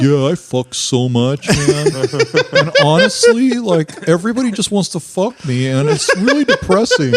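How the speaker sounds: hammed-up whiny imitation, rising high; mocking cohost's complaint. Comic mimicking his depressed cohost's complaint, mock whiny. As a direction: moan a brag as if it were misery. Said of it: "You're like".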